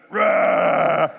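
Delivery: shouted giant roar, full voice. Shown as a demonstration. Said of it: "I want to see lots of giants".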